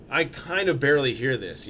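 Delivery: usual speaking voice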